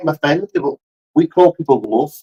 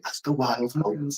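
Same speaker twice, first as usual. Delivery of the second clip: nature documentary voice; 4 semitones lower, 15 dB quieter, slower than usual. Retold or imitated. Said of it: "David Attenborough".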